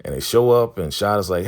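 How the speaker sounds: normal talking voice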